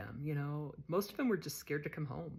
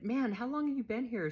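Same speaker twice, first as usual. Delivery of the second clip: higher and louder, amazed; high, loud astonished question; surprised question; 9 semitones higher, 7 dB louder than usual. Retold or imitated. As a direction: ask it in disbelief. Asking Candy her sentence.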